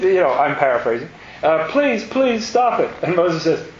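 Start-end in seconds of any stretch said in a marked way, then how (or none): 1.7-2.9 high, slow mock begging; mock pleading Pharaoh. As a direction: plead like a cornered king. Pharaoh begging Moses, retold.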